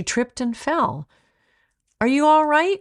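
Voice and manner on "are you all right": high, loud worried call; raised voice, worried mother. A narrator's reading. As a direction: call out in alarm. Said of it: "called her mother".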